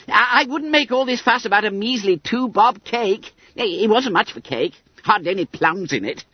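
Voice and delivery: very high, stammering excuse; flustered schoolboy Bunter. Acted. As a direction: squeal evasively, tripping over the words. Bunter dodging, makes excuses.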